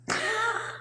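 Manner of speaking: soft, high giggle; short high chuckle, kept soft; light laugh. Real, not acted. Coach thanks a viewer for praise, soft high laugh. Laughs about being herself.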